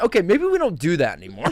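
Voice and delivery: high, loud laughing quote; imitating the director gently. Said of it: "and he went".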